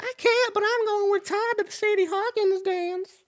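very high, put-on falsetto; cartoonish high female voice; falsetto woman. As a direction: play a girl turning down a date in squeaky falsetto. Voices woman answering Johnny's date request.

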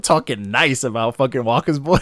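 laughing while hyping it up; excited, words cracking into laughs. Real, not acted. Hype rant breaking into laughter.

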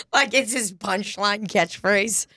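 normal talking voice

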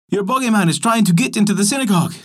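high and fast, breathless alarm; alarmed shout. A narrator's reading. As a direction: rush the warning out in panic. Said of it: "people shouted at his door".

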